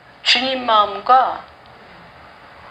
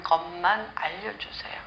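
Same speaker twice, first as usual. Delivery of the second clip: whispered plea; 7 semitones lower, 11 dB quieter than usual. Acted. Hannah's groaning prayer.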